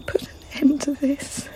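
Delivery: a breathy whispered plea to an absent brother; pleading whisper. Acted. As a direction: whisper it, begging him to come home.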